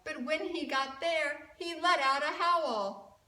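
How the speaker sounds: ordinary delivery, nothing marked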